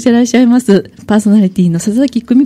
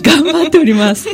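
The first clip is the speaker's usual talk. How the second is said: talking through giggles, higher; laughing; 6 semitones higher than usual. Real, not acted. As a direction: keep laughing as you speak. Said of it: "思わず今笑いがこぼれておりますが".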